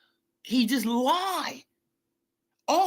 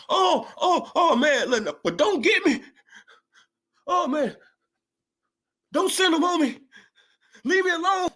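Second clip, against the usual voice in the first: higher, louder hammed-up begging; mock pleading; 6 semitones higher, 3 dB louder than usual. Vlogger pretending to beg critics not to send goons, hammed-up. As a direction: play scared and beg to be left alone.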